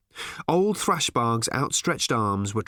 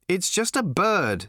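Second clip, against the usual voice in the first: higher, exasperated dismissal; impatient; 7 semitones higher, slower than usual. A narrator's reading. Arthur explains the bird to Ford, impatiently. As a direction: dismiss it as too obvious to explain. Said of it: "said Arthur impatiently".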